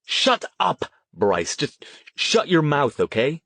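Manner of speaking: high outburst, then exasperated; irritated retort. A narrator's reading. Huff telling Riley to shut up, irritated retort. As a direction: snap back to silence him.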